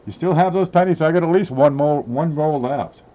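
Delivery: loud, high call across the room. Real, not acted. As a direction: raise your voice to reach someone. Calling out to someone off camera.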